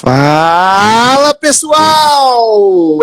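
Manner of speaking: big shouted hello to listeners; excited greeting. Real, not acted. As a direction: open the show with a loud, high greeting. Podcast host's opening greeting.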